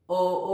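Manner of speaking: "O O" as short, incomplete vowels without glide; undropped-jaw O. Shown as a demonstration. Teacher warning learners whose jaw stays up, incomplete O. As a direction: keep the jaw up, saying "O O" half-formed. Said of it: "ถ้า jaw ของเพื่อนๆไม่ตก".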